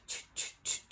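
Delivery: soft unvoiced ch ch ch rattle; maraca shaker sound. Describing the shaman's plant, soft unvoiced maraca rattle. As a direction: shake it like maracas with your mouth. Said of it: "sounds like, um, maracas".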